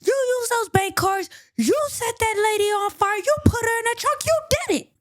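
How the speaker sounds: high-pitched angry shout; losing his cool. Acted. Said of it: "he gets a little upset. He loses his cool".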